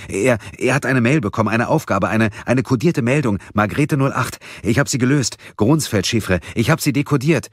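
hastig, höher und lauter; aufgeregt, atemlos. A narrator's reading. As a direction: stolpert aufgeregt über die Worte. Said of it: "Gabriel klang aufgeregt, atemlos". (Gabriel erzählt Mia atemlos von der Mitteilung)

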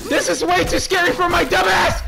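high, loud frightened yell; shrieked high-pitched complaint; panicked scream. Real, not acted. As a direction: scream it in terror at the game. Repeats it in panic.

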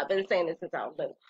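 ordinary delivery

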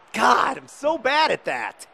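high, loud, slow groan of frustration; exasperated cry at yourself; frustrated shout. Real, not acted. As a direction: yell at your own bad miss. Missed chance, frustrated outburst.

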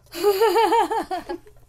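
tràng cười to vút cao; cười sảng khoái; cười phá lên. Real, not acted. (phá lên cười)

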